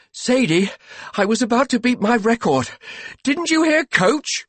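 high, loud, worked-up complaint; panting protest. A narrator's reading. Master to his wife who switched off the machine, panted protest. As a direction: protest as if winded from exercise. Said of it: "he panted".

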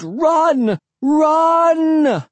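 very high, loud scream. A narrator's reading. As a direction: scream an urgent command. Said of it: "I screamed".

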